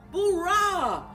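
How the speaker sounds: ordinary delivery, nothing marked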